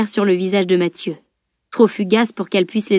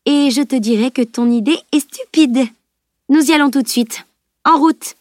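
rising resolve; 5 semitones higher than usual. A narrator's reading. Camille deciding, building up.

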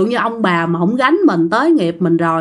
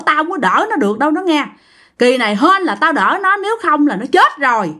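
higher-pitched, insistent family elder; great-grandfather warning; 5 semitones higher than usual. Acted. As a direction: lay down a stern warning to your descendants. Ông cố cảnh cáo con cháu.

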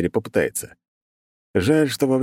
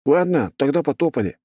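chewing while talking; mouth full; 8 semitones higher than usual. A narrator's reading. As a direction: talk through a mouthful of tomato. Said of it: "пацан, чавкая".

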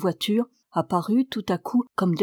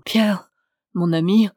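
call for help; 5 dB louder than usual. A narrator's reading. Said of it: "jeta comme un appel au secours".

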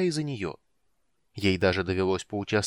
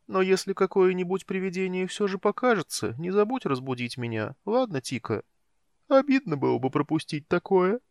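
higher, keen curious kender; eager kender voice; 10 semitones higher than usual. A narrator's reading. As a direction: sound thrilled at ghosts. Tass eager for ghosts.

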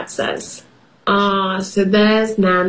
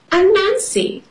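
eager; 11 semitones higher than usual. Acted. Greeting new kid eagerly.

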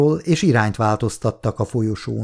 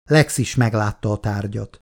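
ordinary delivery

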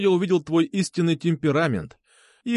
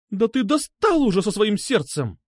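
higher-pitched exasperated outburst; irritated yell; 8 semitones higher than usual. A narrator's reading. Girl fed up with his broken-heart talk, irritated yell. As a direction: snap at him in a raised, high voice. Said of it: "прикрикнула она".

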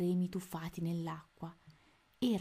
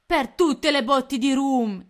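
loud, slow, higher exclamation; pirate oath; 7 semitones higher, 12 dB louder than usual. A narrator's reading. Pirate captain astonished in the fight, loud oath. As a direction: roar the pirate curse. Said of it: "esclamò Codanera".

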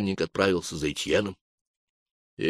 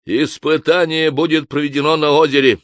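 solemn proclamation; 8 semitones higher, 7 dB louder than usual. A narrator's reading. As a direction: proclaim it like a sermon. Inquisitor decrees.

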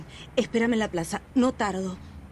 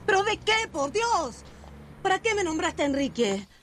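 much higher, alarmed cry; startled protest; 8 semitones higher than usual. Acted. Sister caught out by brother's question, alarmed. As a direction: jump up in startled panic. Sister confronted about her secret.

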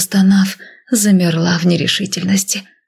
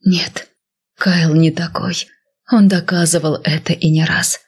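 ordinary delivery

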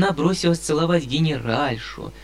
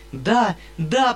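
eager agreement; 7 semitones higher, 3 dB louder than usual. A narrator's reading. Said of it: "подхватила Сашенька".

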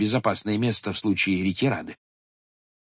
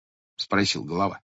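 ordinary delivery

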